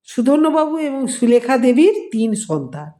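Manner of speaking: plain reading voice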